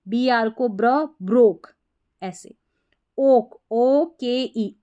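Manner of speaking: ordinary talk, nothing marked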